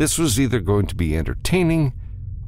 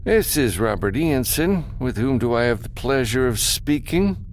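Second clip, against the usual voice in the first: higher-pitched, formal phone greeting; smooth businessman; 9 semitones higher than usual. Acted. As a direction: answer the call as a formal businessman. Businessman answers a comm call.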